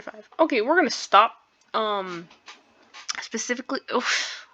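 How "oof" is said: usual speaking voice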